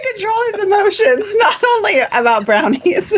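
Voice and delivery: playful high teasing voice. Acted. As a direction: tease him in a sing-song. Teasing about his emotions.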